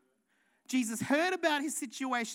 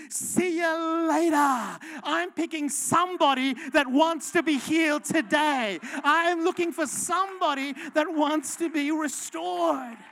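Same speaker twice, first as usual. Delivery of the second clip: louder, slower, shouted challenge; pulpit-pounding call to respond; full force preaching; 7 dB louder than usual. Acted. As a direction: hammer each question at the crowd.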